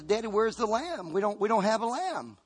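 Isaac asking. Acted. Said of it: "Isaac looks around and says".

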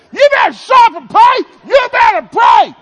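high, loud, slow scolding yell; angry shout. Retold or imitated. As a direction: scold the giggling girl at full voice. Yelling at laughing daughter.

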